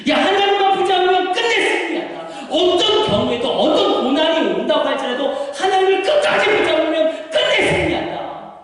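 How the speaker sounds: high, rousing preacher's cry; urgent parental exhortation. Acted. Parent assuring child of victory, rousing exhortation. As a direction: promise victory with full fervor.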